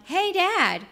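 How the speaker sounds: high, loud call of Hey, Dad; boy's yell. Retold or imitated. Son calling his dad while jumping off the rock, loud and high. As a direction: shout it mid-jump. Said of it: "He had jumped and then yelled".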